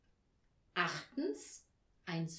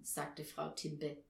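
ordinary delivery

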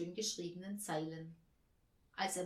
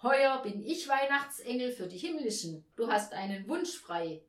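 raised-voice proclamation; calling out; 4 semitones higher, 7 dB louder than usual. A narrator's reading. Chief angel granting Aurelius a wish, loud announcement. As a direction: announce it loudly to the returning angel. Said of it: "rief der Oberengel zur Begrüßung".

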